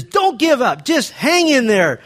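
loud, high, drawn-out urging to hang on; urgent coaching shout. Retold or imitated. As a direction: yell encouragement at a struggling student, stretching it out. Coach urging breath-holding student.

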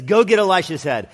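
king ordering his commander, loud and high; king's order. Acted. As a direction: bark it like a furious king.

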